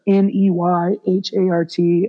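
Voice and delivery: ordinary talk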